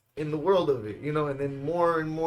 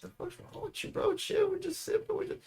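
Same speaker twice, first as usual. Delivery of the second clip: dismissive scoff; 5 dB quieter than usual. Retold or imitated. Brushing off the worried brother.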